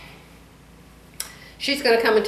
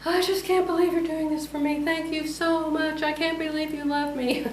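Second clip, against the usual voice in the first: imitating grateful mother; 8 semitones higher, 4 dB quieter than usual. Daughter imitating her mother thanking her, in a high soft voice. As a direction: lift into a small sweet voice to play her thankful mom. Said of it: "She thanks me all the time".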